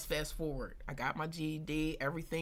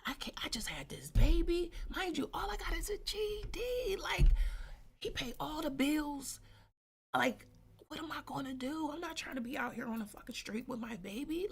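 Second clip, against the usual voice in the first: higher-pitched, worried re-enactment; retold freak-out; 9 semitones higher than usual. New mother thinks of leaving her husband, retold panic. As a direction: replay your panic in a raised pitch. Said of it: "I'm freaking out".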